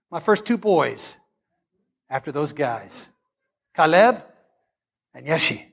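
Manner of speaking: ordinary talk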